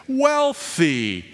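drawn-out, ominous intoning; mock-spooky foreboding; premonition. Shown as a demonstration. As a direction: stretch it out like a scary omen, slowing to the end. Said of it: "meant to sound like a premonition".

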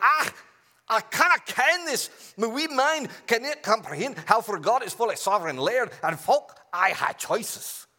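high, loud hammed-up Scottish brogue; over-the-top Scottish lament; mock Scottish accent. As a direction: play a baffled Scotsman, broad Scottish. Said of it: "think about the interplay of God and humans in a Scottish accent".

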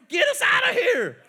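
a frightened shriek at Moses; high shout. Shown as a demonstration. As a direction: scream for rescue. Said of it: "Tell him".

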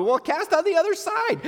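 high, sing-out call across water; calling from shore. Acted. Somebody on the shore advises the fishermen, calling out. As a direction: sing out advice to a far boat.

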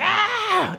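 short, high mock scream of a frustrated toddler; mock child scream. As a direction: squeal it like an angry little girl. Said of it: "she starts screaming".

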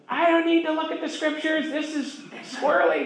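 high-pitched mock outrage at a friend; indignant outburst. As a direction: blurt it out like a hothead refusing to listen. Retold heated argument with friend.

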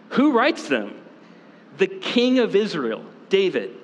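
usual speaking voice

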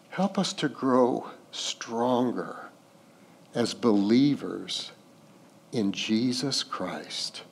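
soft, unhurried pastoral prayer. Real, not acted. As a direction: pray gently, letting each phrase settle and fade. Leads a closing prayer.

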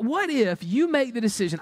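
ordinary talk

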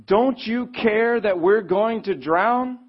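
slow, high, loud cry for help; shouting. A narrator's reading. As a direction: yell it out slowly over the storm.

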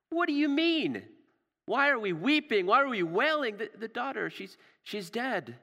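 high-pitched, scoffing disbelief; incredulous mockery. Crowd answering Jesus beside the dead girl, acted scornful disbelief. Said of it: "begins to laugh at him".

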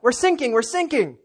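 high, loud acted radio plea; urgent mock cry for rescue, Australian accent; distress call. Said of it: "you hear a distress call".

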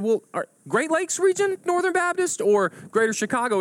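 high, eager quizzing; hammed-up breathless hope for a match; excited questioning. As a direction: press the question in a high, giddy voice. Joke: excited narrowing question.

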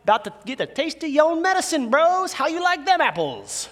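a hammed-up spiteful gloat; gloating taunt. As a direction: needle the brothers with a smug payback line. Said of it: "he's not sitting here going".

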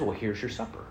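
low, soft parent's reply; understated, matter-of-fact mother; patient mom. Acted. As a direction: play Mom quietly offering a meal. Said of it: "Mom says".

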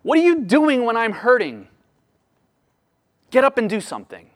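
high, urgent plea to God; hurting worshipper pleading with God to act, urgent. Retold or imitated. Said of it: "plead with God and say".

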